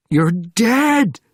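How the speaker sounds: loud, high-pitched scream; panicked scream. A narrator's reading. Sergeant repeating it to a man back from death, panicked scream. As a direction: scream it in wide-eyed panic. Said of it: "screaming, his eyes wide in panic".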